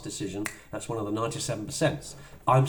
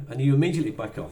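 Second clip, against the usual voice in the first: ordinary talk, nothing marked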